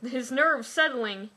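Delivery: normal talking voice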